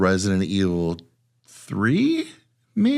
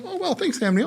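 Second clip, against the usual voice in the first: sarcastic; 3 dB quieter than usual. Retold or imitated. Sarcastic thanks to character.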